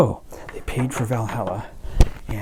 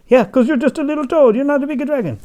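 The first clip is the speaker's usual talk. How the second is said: much higher, louder teasing voice; high mocking taunt; 11 dB louder, faster than usual. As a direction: taunt the little toad. Mocking the toad.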